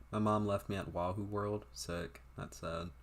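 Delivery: normal talking voice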